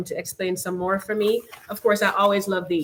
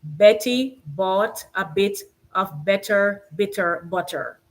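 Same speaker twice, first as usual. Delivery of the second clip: slow and careful, every b crisp; slow tongue twister; slower than usual. Shown as a demonstration. Host reading a tongue twister to viewers, slow and careful. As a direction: ease through the tongue twister slowly. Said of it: "I'll try to go kinda slowly".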